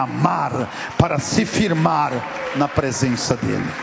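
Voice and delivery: loud, emphatic sermon climax; full-force preaching. Real, not acted. Preacher calling the congregation to stand, at full force. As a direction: proclaim it to the whole church, punching each phrase.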